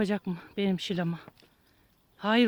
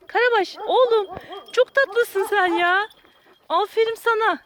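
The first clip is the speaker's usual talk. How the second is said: cooing to a dog; 8 semitones higher, slower than usual. Real, not acted. (köpeğe yüksek ve yavaş, bebeğe konuşur gibi sevgi sözleri)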